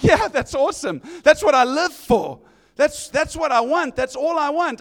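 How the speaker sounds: loud, high and glad; thrilled that they left him. Retold or imitated. [name] voiced, delighted.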